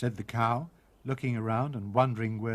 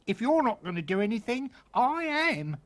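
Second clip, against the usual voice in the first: exasperated cook; 10 semitones higher than usual. Acted.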